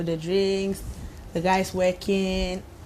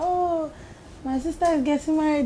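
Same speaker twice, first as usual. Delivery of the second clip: higher, quick mimic of a caller; imitating caller; 8 semitones higher than usual. DJ mimicking a client who calls her, higher and quicker. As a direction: lightly play an eager caller, higher. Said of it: "be like".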